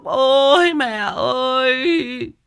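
high, loud, very drawn-out fake sob-call; mock wailing. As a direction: imitate a crowd of kids bawling at a funeral. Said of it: "cứ đi theo khóc". (giả khóc lóc kéo dài thảm thiết)

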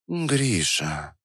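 soft purr into his ear. Acted. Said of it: "промурлыкала она прямо в ухо".

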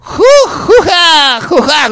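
very high, loud cartoon hoot; Larguirucho laugh. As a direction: shriek like the cartoon. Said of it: "ahí va".

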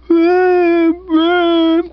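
high loud wailing whoops; young woman flung on the bed, loud high sobbing whoops. A narrator's reading. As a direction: wail it out twice, high and loud. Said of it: "started going whoop".

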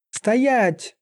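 high-pitched sharp order; shouted halt, voice pitched high; commanding cry. A narrator's reading. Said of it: "выкрикнула она".

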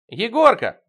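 loud, high call of the name; high, insistent holler; calling on the phone. A narrator's reading. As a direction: hail him down the receiver. Алиса keeps calling down the phone.

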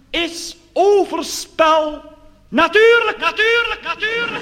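very high, slow natuurlijk repeated fading; mock reverb turning question to answer; echo gag. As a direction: mimic a booming church echo. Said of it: "de vraag".

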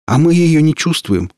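cocky high-pitched boast; smirking. Acted. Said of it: "ухмыльнулся парнишка".

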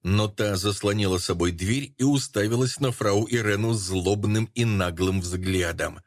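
ordinary delivery

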